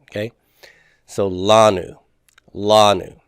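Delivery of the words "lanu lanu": "lanu" with a long, stressed "la"; LA-nu, accent up front in "lanu"; stress on first syllable. Shown as a demonstration. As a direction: lean on the "la" of "lanu", letting "nu" fall away. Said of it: "It's on that first syllable".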